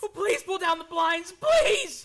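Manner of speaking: high, loud pleading that climbs to a scream; panicked shouting. Acted. Man begging for the blinds down, panicked shout. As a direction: beg desperately, rising to a cry.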